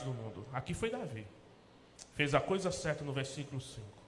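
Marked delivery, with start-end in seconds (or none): none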